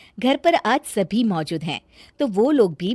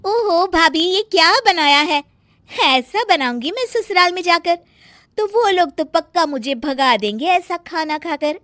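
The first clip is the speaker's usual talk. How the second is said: teasing, higher; mocking Sonam; 9 semitones higher, 5 dB louder than usual. Sonam mocking her sister-in-law's cooking, lightly raised teasing voice. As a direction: tease her about the food.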